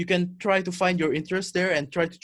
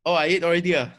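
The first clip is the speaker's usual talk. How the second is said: Singlish; 3 semitones higher, 6 dB louder, faster than usual. Pretend. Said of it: "let's exchange a few sentences in Singlish".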